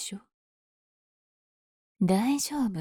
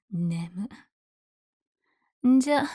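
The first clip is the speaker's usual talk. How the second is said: usual speaking voice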